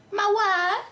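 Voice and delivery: high, put-on Borat-style Russian accent. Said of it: "I have this heavy Russian accent".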